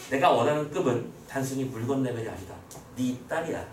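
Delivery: lightly acted low, quiet declaration; secretive scheming hero. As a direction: state the hidden aim low and measured. Voices hero's secret aim.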